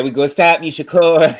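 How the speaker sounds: teasing imitation of a boxer's threat; imitating Shakur's rival. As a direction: lampoon the threat, laughing. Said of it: "he said".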